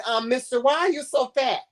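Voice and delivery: put-on high, whiny kid asking; squeaky child asking why he is so fat. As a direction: play a blunt little kid. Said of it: "if a child comes to you and say".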